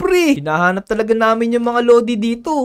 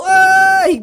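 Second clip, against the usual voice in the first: startled yelp; 6 semitones higher, 5 dB louder than usual. Acted. Startled by nearly falling.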